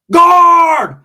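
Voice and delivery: loud, high call for the guard; yelling for the guard from the holding cell; shout for guard. Retold or imitated. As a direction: bellow for the guard. Calling out for the guard.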